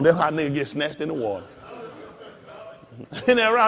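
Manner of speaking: normal talking voice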